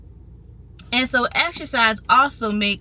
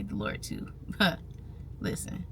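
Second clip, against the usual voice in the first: hushed aside; 3 semitones lower, 14 dB quieter than usual. Real, not acted. Aside about the Lord.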